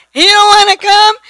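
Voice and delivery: fed up that he won't come; exasperated. Real, not acted.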